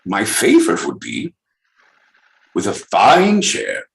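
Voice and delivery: lightly voiced proper English gentleman; Queen's English. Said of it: "in the Queen's English".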